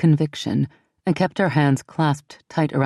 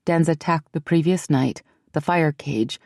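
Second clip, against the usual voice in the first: ordinary delivery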